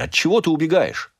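high-pitched, pressing question; demanding challenge. A narrator's reading. Husband confronts Rachel about running away, demanding. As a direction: demand an answer, pitch jumping up.

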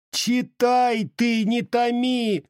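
very high, drawn-out wail without extra volume; impatient cry. A narrator's reading. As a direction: cry out slowly at the top of the pitch range. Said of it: "закричала Ильинишна". (высокий протяжный крик нетерпения)

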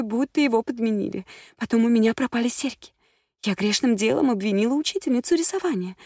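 высокий голос капризной дамы, взахлёб; жалуется, частит без остановки; тараторит взволнованно. A narrator's reading. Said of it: "затараторила она". (дама взахлёб жалуется гадалке)

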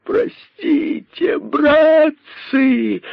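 очень высоко, медленно, протяжно вопит; взвой о прощении; вопль. Acted. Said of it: "вопил находчивый". (находчивый вопит, умоляя кроликов о прощении)

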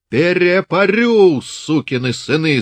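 high, slow, drawn-out yell; bellowing from afar. Acted. Father yelling threats at his sons from far off, bellowing. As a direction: roar it across the field like a furious old Cossack. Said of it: "завопил он ещё издали".

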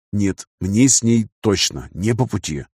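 plain reading voice

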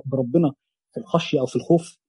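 ordinary talk

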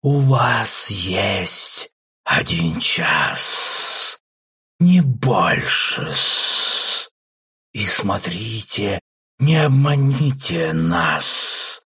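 very slow, hissing snake voice; hissing serpent. Acted. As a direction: draw out the threat like a serpent. The serpent sets the humans' terms.

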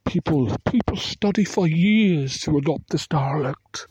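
put-on slow, high-pitched upper-class British accent; high British airs. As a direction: mimic a regal British girl, drawn out and high. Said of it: "high British airs or whatever".

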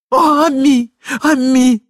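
высокий громкий вопль горя; возопи высоко и протяжно; причитание дамы. A narrator's reading. Дама оплакивает собачку. (истеричный плач по любимице)

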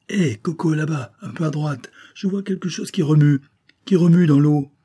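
high, loud excited call; excited exclaim. A narrator's reading. The boy spots something in the water, excited call. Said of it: "le petit s'exclama".

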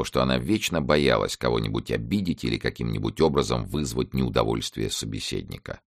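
ordinary delivery, nothing marked

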